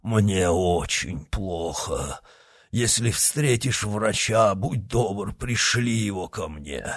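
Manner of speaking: slow, weary, pained plea; suffering voice. A narrator's reading. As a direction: drag the words out like a badly wounded man. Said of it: "сказал Портос страдающим голосом".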